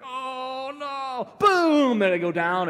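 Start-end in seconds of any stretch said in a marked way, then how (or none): 0.0-1.3 high, drawn-out oh no; mock horrified reaction. Imitating reaction to skateboard fail. 1.4-2.0 high, loud, drawn-out boom; explosive mock crash noise; imitated crash.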